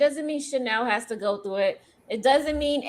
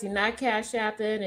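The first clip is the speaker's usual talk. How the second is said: ordinary talk, nothing marked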